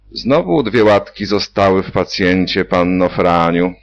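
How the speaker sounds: bantering surgeon jokes with nurse; joking. A narrator's reading. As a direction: tease her with a grin in the voice. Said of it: "mówił z humorem".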